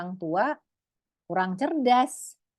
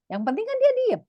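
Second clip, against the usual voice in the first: exasperated giving in; 4 dB louder, faster than usual. Acted. Parent giving in to quiet child.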